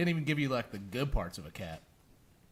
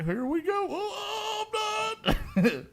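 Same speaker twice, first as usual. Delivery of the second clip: very high, slow, louder talking-cat voice; voicing the cat Brutus; 4 dB louder, much slower than usual. Brother pretending to be the cat calling his sister, high and slow. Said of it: "I was just like".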